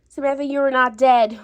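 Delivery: ordinary talk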